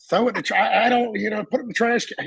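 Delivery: hurried. Retold or imitated. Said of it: "it's just".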